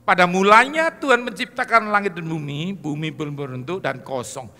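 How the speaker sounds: loud, rising proclamation of Genesis; scripture reading at full force. Acted. Preacher to congregation, loud proclamation. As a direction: read the creation verses like a preacher thundering.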